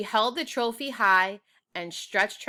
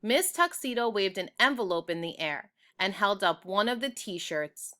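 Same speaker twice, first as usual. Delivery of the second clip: plain reading voice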